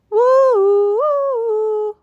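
high, loud siren woo; siren wail. Acted. Siren sound.

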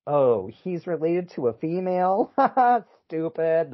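hammed-up dismissive ridicule; mock scorn. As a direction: scoff at an absurd claim with a laugh. Voicing scornful French reaction.

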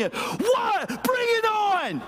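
high, slow shouted dare with a laugh; mock challenge shout. Pastor retelling parking lot fight, shouting a laughing dare. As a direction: yell it like picking a fight, half laughing. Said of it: "and I literally".

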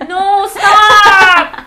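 high, drawn-out shout; loud protest. Real, not acted. Friend teased about pronunciation, shouting in protest. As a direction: yell it out in exasperated dismay.

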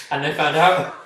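laughing. Real, not acted. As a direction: laugh out the punchline. Laughing punchline.

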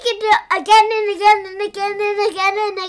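loud excited, spilling over. Real, not acted. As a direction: ask it bursting with excitement. Child's excited question.

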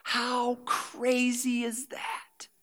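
slow hushed wonder; awed whisper. Real, not acted. Pastor marveling to the church, whispered. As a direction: whisper it in awe.

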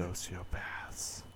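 slow eerie whisper; whispered reveal. Pretend. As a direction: whisper it slowly like a secret. Dramatic reveal.

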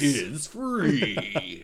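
punchy promo voice; monster truck ad. Acted.

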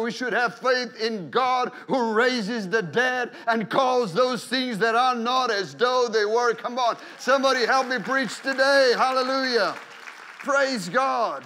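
loud, rising preacher's climax; preaching at full force. Acted. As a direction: rouse the congregation to praise.

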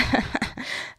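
soft breathy villain snicker; a short malicious unvoiced laugh; gloating laugh. A narrator's reading. Victor laughing at the trapped children, malicious snicker. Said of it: "lachte er hämisch".

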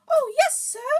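earnest, very high loud elf; Dobby. A narrator's reading. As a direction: squeak it earnestly in falsetto. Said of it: "said Dobby earnestly".

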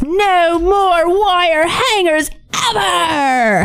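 drawn-out furious shriek, high and slow; screaming mother. Retold or imitated. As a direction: scream it like an unhinged movie mother. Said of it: "the infamous".

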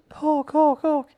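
high squealing chant of Hulk; excited little kid. Retold or imitated. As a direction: chant it like a thrilled toddler.

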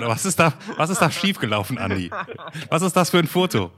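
mock indignation. Interviewer teasing guest about choosing a cat photo, mock indignation. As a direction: demand an explanation in mock disbelief.

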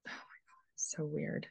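unvoiced stunned whisper. Real, not acted. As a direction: breathe it out in awe. Stunned at photo detail.

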